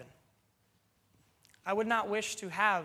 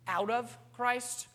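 "out of" pitched higher and slow, set apart as the wrong phrase; slow, raised "out of Christ", quoting what the text does not say; mock wrong reading; 5 semitones higher, slower than usual. Shown as a demonstration. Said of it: "The text does not say".

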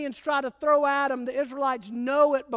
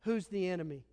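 quiet question; 6 semitones lower, 6 dB quieter, faster than usual. Shown as a demonstration. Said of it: "Question one".

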